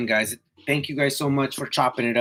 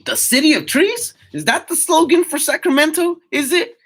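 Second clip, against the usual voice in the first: high, loud, amazed reading of the comment; can't believe what he's reading; surprised question; 9 dB louder than usual. Real, not acted. Live streamer reads a chat comment, loud high astonishment.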